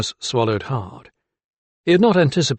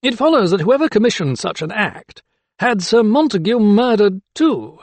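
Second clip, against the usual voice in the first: higher, louder, slowing to finish; magistrate in full flow; 9 semitones higher, 4 dB louder than usual. A narrator's reading. Magistrate in full flow accusing, pitched high. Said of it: "in full flow".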